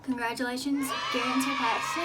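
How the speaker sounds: calling out. Real, not acted. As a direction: call out across the room. Calling out to the couple.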